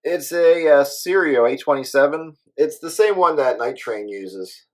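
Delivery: ordinary talk, nothing marked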